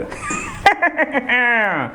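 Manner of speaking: long, high put-on laugh; mimicked actor's drawn-out cackle. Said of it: "మన JP గారు".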